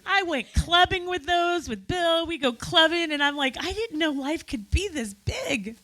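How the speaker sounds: thrilled, wide-open exclaiming; exuberant recollection. Real, not acted. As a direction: marvel out loud at a wild memory. Eulogy, exclaiming over nights out.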